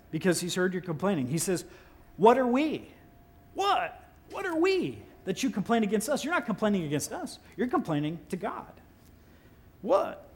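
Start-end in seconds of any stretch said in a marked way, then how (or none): 2.2-10.3 Moses scolding. Acted. Moses scolding the complaining Israelites.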